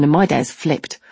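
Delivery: usual speaking voice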